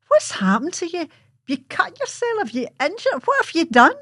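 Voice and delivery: a mother panicking at the sight; alarmed mother. Retold or imitated.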